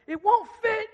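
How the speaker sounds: put-on high, loud, angry kid's wail; mock toddler tantrum; frustrated child yell. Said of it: "he's, like, so mad".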